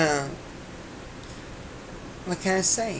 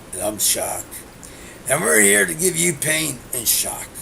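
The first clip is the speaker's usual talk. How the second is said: Shock's voice. Retold or imitated. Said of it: "we used to have two guys".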